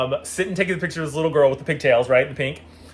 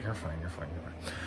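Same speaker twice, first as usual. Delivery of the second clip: lower, hushed comforting repeat; soft reassurance; 11 semitones lower, 16 dB quieter than usual. Retold or imitated. Mom soothing the apologetic Grinch, low and hushed. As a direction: soothe him under your breath.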